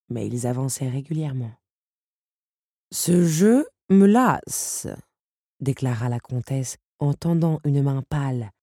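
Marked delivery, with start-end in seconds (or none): 2.9-4.9 weary disdain. Acted. Countess impatient with the game.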